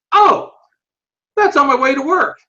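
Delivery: high, loud "oh" of sudden recognition; a friend blurting out that he knows the place; surprised realization. Retold or imitated.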